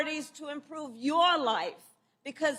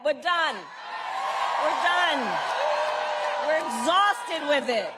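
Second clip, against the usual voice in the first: rally speech. Acted. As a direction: rouse the crowd, hitting each repeat. Rally speech to cheering crowd.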